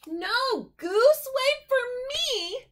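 high, loud plea; calling out. Acted. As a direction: shout after him. Duck calling after Goose.

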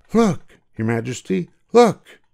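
rising to a high, loud cry on look; shout. Acted. Boy showing the king his bare head, high joyful shout. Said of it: "he shouted to the king".

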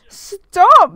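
loud mock-panicked cry; sudden loud mock protest; shout. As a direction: yell stop in pretend alarm. Intimidated by guy with bat.

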